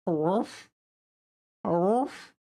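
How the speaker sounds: playful high little "aroof" barks; mock dog bark. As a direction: bark back at the dog like a pup. Talking to the dog, barking.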